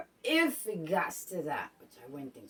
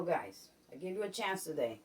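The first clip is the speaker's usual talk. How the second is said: ordinary delivery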